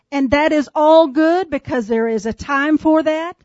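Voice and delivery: ordinary talk, nothing marked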